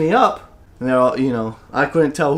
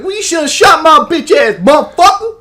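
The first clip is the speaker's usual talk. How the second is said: high, loud yell standing his ground; defiant shout; 7 dB louder than usual. Retold or imitated. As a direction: bellow it back at the kid, cocky. Retold defiant shout at kid.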